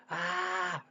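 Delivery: a high startled yelp; toxins crying out. Retold or imitated. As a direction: squeal it out like a fleeing toxin. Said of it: "all your toxins suddenly go".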